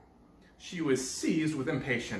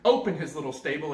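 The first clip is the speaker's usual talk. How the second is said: plain reading voice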